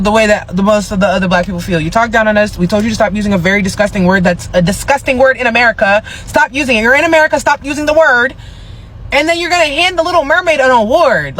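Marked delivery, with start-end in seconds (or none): 4.2-11.4 high-pitched, heated telling-off; exasperated scolding. Acted. Scolding [name] over the word and award, high exasperation.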